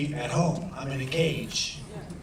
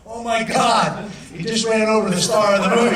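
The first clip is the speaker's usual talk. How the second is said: shocked exclamation; 7 semitones higher, 8 dB louder than usual. Retold or imitated. Director shocked at knockdown.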